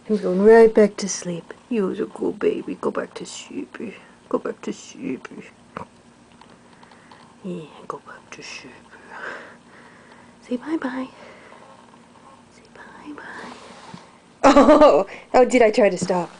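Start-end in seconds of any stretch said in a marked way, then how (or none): 1.7-3.8 hushed whisper to a sleepy pet. Real, not acted. 4.3-5.3 soft spoken. Real, not acted. Lulling pet to sleep. 7.4-13.5 hushed whisper to a sleepy pet. Real, not acted.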